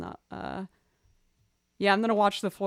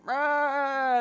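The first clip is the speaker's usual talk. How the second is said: higher, louder mock cry of protest; imitated pet wail; 6 semitones higher, 6 dB louder than usual. Teller mimics pet taken to bathroom, loud protest cry. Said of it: "so he'll be like".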